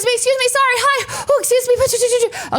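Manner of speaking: very high, squeaky mock-frantic voice; frantic apologies. Sprinting traveler apologizing through the crowd, high and frantic. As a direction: squeak apologies while dodging people.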